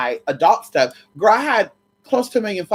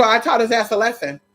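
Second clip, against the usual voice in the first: ordinary talk, nothing marked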